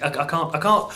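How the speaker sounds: ordinary talk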